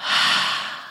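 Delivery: long breathy release of air; audible exhale sigh. Shown as a demonstration.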